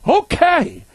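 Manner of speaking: high, loud startled exclaim; startled whoa. Retold or imitated. Guest cornered by a pushy host, startled whoa. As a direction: jump back at the pushy offer.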